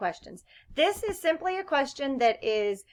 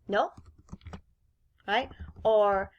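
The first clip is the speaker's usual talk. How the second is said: ordinary talk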